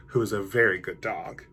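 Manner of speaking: usual speaking voice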